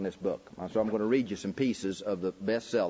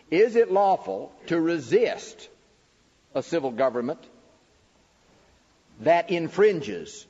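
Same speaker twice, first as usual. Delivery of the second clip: raised, slow, emphatic preaching of each phrase; preaching the questions; 6 semitones higher, 5 dB louder than usual. Acted. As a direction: declaim every question like a sermon point. Reads second and third questions.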